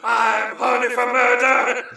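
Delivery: high, sustained industrial-metal chant; hammed-up monotone rock yell.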